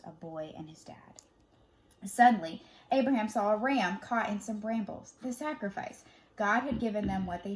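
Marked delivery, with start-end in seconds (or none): 0.0-1.2 hushed, tender whisper. A narrator's reading. Telling children the father and son embrace, whispered. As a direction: breathe it out softly to the children. Tender end of the rescue scene.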